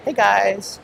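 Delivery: bright high "Hey guys" of a chirpy vlogger; mock vlogger greeting. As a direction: imitate a travel vlogger's perky opener. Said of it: "She's a Hey Guys channel".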